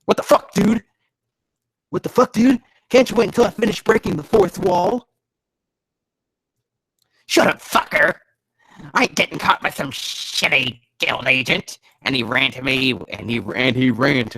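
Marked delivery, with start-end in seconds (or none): 0.0-5.1 high, loud protest; pitched-up indignant rant; exasperated yelling. Acted. Exasperated yelling at the story's interruption. 7.2-11.8 loud, high angry retort; acted angry outburst; defiant thief. Acts the thief snapping back.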